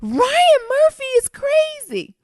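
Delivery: very high, slow squeal; loud, stretched-out astonished shriek; amazed exclamation. Real, not acted. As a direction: draw it out high in gleeful disbelief. Exclaiming at the show's madness.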